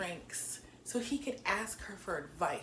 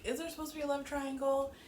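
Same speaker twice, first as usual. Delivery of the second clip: high quick question; 8 semitones higher, faster than usual. Shown as a demonstration. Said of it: "I said this last week".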